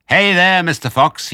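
high, loud holler; taunting yell. A narrator's reading. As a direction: call out to your hidden prey. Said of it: "yelled Bunce".

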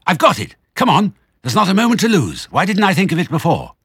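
high, loud sudden shout; excited shout. A narrator's reading. Mister Fox shouting to his family he has the answer. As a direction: burst out with the big idea. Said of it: "He jumped up and shouted".

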